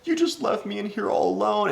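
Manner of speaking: high-pitched mock whine of a tearful ex; hammed-up crying girlfriend voice; imitating crying girlfriend. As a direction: whimper it way up high, mimicking her. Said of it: "Why are you crying?".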